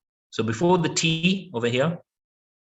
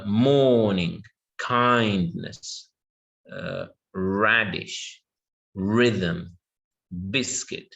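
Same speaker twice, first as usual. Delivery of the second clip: "morning, kindness, radish, rhythm, biscuit" slowly, each first syllable strong; stress on first syllable; 4 semitones lower, much slower than usual. Shown as a demonstration. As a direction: lean on MOR, KIND, RAD, RHY, BIS in "morning, kindness, radish, rhythm, biscuit". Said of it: "will be on the first syllable".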